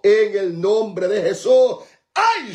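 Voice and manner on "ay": ecstatic cry. Real, not acted.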